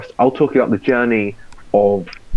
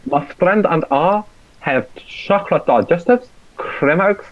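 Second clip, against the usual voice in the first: hammed-up South African accent, pitched higher; 6 semitones higher than usual. Listing car snacks for the podcast, mock South African accent. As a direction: keep the update going in a bad South African accent.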